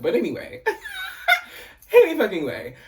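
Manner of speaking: laughing it off. Real, not acted. Dropping the tangent about dating a West Indian man, laughing it off. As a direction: laugh at your own rambling and move on. Laughs off own rambling digression.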